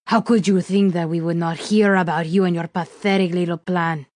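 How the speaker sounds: contemptuous put-on villain voice; scornful villain. A narrator's reading. Villain laughing at captive's failed plan, scornful.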